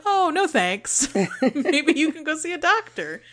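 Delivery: high, easy no thanks, not nervous at all; high carefree decline; breezy refusal. Retold or imitated. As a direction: turn it down lightly, unbothered. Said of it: "very funny the way she says no".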